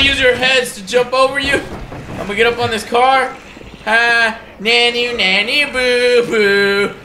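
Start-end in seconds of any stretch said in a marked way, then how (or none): 3.8-6.9 higher, sing-song playground teasing; childish taunt. Pretend. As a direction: tease them like a kid in a playground.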